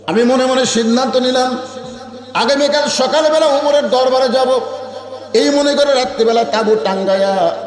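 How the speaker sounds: high, ringing sermon chant; impassioned preaching. Acted. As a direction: preach it high, stretching the phrase endings. Preacher at full pitch.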